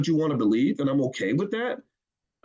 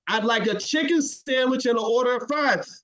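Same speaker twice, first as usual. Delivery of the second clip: same order again, firmly and higher; insistent repeated order; 7 semitones higher than usual. Retold or imitated. Customer insisting on the order, higher and firmer. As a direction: say it again with more push. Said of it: "And you say".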